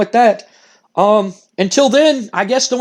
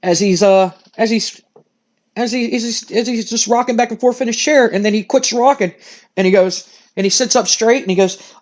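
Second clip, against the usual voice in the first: normal talking voice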